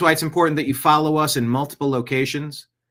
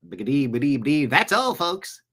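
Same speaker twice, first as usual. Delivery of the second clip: stuttering cartoon sign-off voice, imitating; cartoon pig sign-off. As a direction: stammer it like the old cartoon pig. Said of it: "Mel Blanc did all those voices".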